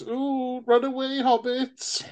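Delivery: high, soft wailing wraith; Ring Wraith. Acted. Host introducing himself as the Ring Wraith, mock-spooky wail. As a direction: moan it like a spooky phantom, high and drawn out. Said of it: "I'm the Ring Wraith".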